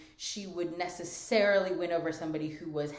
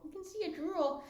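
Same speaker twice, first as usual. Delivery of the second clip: pitched way up, talking to the dog; 11 semitones higher than usual. Real, not acted. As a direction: coo it high like to a pet. Repeats it to the dog, high.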